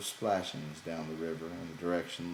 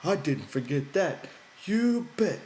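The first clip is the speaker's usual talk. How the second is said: much higher, louder gleeful boast; happy, proud; 11 semitones higher, 7 dB louder than usual. A narrator's reading. Lenny boasting to George he remembered, high gleeful. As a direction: crow it like a pleased child. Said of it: "Lenny giggled happily".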